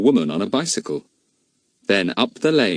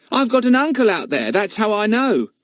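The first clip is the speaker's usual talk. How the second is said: put-on young boy; 14 semitones higher than usual. A narrator's reading. Telegraph boy speaks.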